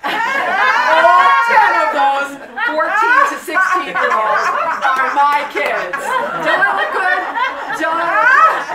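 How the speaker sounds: put-on a playful New York accent claiming the kids, half laughing. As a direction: pretend comeback in a New York accent, loud through laughter. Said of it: "With a New York accent".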